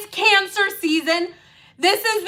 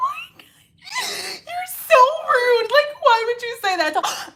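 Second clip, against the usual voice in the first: fake crying; 9 semitones higher than usual. Shown as a demonstration. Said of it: "switch them all on in one second".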